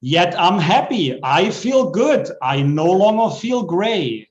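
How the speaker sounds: bouncy comic poem reading with a rhyme beat; poem recital. Acted. Poet reciting a comic poem to the open mic. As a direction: perform the silly rhyme for the crowd.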